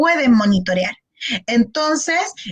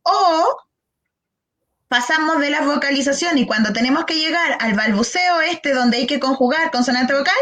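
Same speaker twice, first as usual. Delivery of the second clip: ordinary talk, nothing marked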